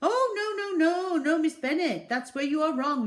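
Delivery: very high, loud hurried fib; a shopkeeper bluffing his customer; high loud nervous brush-off. A narrator's reading. Raj voiced.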